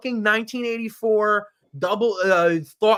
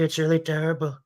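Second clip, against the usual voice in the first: Trump impression; 4 semitones lower, faster than usual. Trump repetition.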